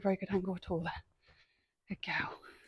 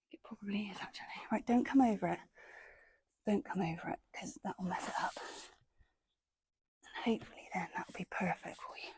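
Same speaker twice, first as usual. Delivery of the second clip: whispering; 8 dB quieter than usual. Real, not acted. Quiet asides while moving poles.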